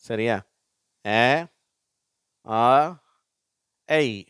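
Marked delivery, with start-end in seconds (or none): 1.0-4.2 ஏ as "ae", ஆ as "aa", ஏய் as "ey"; three vowel sounds. Shown as a demonstration. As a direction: demonstrate ஏ, ஆ, ஏய் separately with pauses. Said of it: "மூன்று உச்சரிப்புகளும்".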